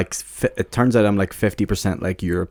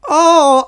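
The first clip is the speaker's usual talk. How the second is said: loud high mock "oh!"; mock exclamation; 10 dB louder than usual. Family making a loud high "oh!" in Asia land. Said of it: "they all start going".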